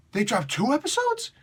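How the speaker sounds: high, amazed disbelief; stunned disbelief. Real, not acted. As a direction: let the surprise jump up high. Surprise at double release.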